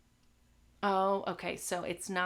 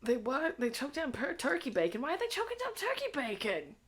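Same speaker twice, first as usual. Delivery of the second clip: much higher, disbelieving squeal of surprise; incredulous; 10 semitones higher, 4 dB louder than usual. Real, not acted. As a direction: react like you can't believe what you just read. Shocked at chat about turkey bacon.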